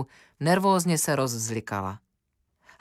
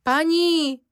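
second cry even higher and loud, near a scream; shrieking louder; 13 semitones higher, 4 dB louder than usual. A narrator's reading. Cook screaming for the lady after dropping the cup. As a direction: call again, more desperate. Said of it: "křičí kuchařka".

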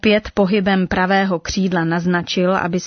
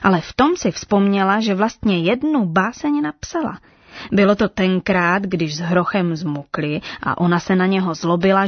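plain reading voice

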